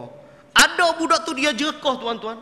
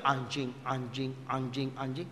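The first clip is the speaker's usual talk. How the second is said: low, soft, slow lightly voiced old sheikh; mimicking sheikh; 10 semitones lower, 11 dB quieter than usual. Blind old sheikh repeating the word, lightly voiced. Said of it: "Oh, dia".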